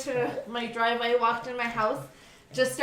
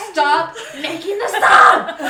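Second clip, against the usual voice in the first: loud laughing yell; half-laughing shout, slowly; mock scream at the sandwich guy; retold scream; 4 semitones higher, 10 dB louder, slower than usual. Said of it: "screamed at him".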